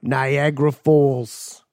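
slow, drawn-out character line; impression voice. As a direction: stretch it out in character. Movie quote impression.

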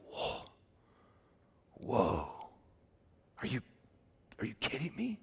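hushed, halting disbelief; stunned awe. Retold or imitated. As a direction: barely voice it, long pauses between the gasped words. Acting out groom stunned by bride.

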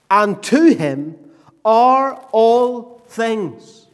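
emphatic pulpit reading, each phrase set apart by pauses; declaimed scripture, building. Acted.

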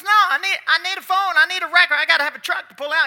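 high, loud and fast, pleading; insist, getting desperate; frantic insisting. Retold or imitated. Coach insisting in story.

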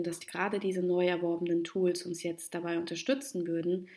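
normal talking voice

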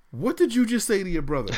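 stern parent question. Retold or imitated. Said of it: "I said".

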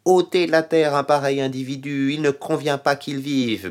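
high, loud mob cry of outrage; crowd shouting. Retold or imitated. The Jerusalem crowd shouting against Paul, high and loud. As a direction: yell it out as the furious crowd. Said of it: "on se mit à crier".

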